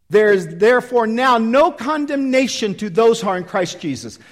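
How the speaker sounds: loud, high, fervent preaching; rousing pulpit preaching. Acted. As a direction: preach it out with full force to the crowd. Preaching at full force.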